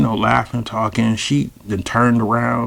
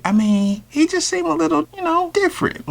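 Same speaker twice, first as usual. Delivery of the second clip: very high, careful hedging; tentative. Retold or imitated. Man delicately asking a mother about her son, high and hesitant. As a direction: tiptoe round it in a high voice.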